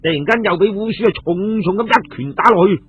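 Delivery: usual speaking voice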